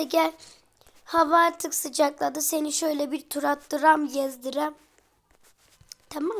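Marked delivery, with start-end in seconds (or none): none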